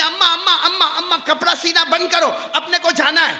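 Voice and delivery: put-on high, eager boy calling amma repeatedly; a young son pleading and calling out; child calling mother.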